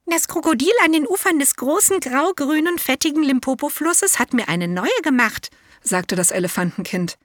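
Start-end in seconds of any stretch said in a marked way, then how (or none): 0.1-5.5 high, eager little elephant voice; a proud young elephant, pitched way up; elephant child. A narrator's reading. Elephant child tells relatives in a high childlike voice.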